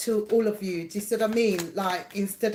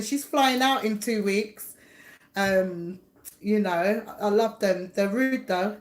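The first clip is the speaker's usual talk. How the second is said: ordinary talk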